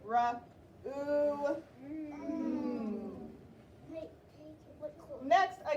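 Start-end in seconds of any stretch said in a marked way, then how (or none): 0.0-3.3 "Ra, oo, Mm" one held sound at a time. Shown as a demonstration. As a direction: hum the final "Mm" long and level. Teacher sounds out room.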